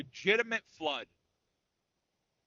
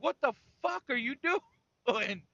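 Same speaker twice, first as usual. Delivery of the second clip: higher mock-angry yell broken by a laugh; pitched-up mock rage; imitating angry dad; 7 semitones higher than usual. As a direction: play the furious trucker, half laughing. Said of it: "he was pissed".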